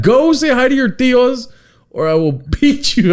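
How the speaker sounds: hammed-up order to go greet the tios; mock threatening parent. Parent ordering a kid to greet relatives, mock threat. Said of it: "I'm just kidding".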